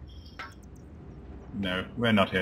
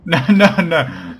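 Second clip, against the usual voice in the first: loud, slow, drawn-out no's; panicked shout; 7 semitones higher, 12 dB louder, slower than usual. Real, not acted. Gamer yelling at his party mid-fight, a loud panicked shout. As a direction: yell it out in real alarm.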